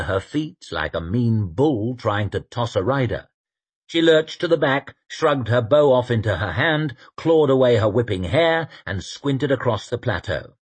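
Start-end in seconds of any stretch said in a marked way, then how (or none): none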